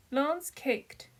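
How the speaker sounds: ordinary delivery, nothing marked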